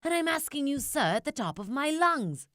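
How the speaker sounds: high, bossy shouting voice; Lorax shouting. A narrator's reading. Lorax shouts at the Onceler for the trees, high and bossy. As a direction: shout it at the top of your lungs. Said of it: "as he shouted and puffed".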